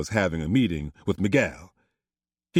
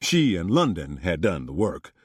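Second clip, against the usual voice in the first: plain reading voice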